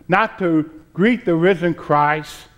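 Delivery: usual speaking voice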